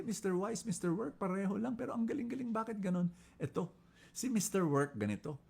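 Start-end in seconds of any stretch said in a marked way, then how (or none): none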